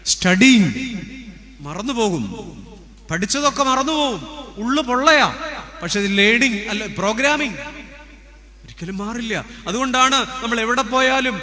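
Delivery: loud, high, fervent preaching; full-force sermon delivery. Acted. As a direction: drive it home to the congregation.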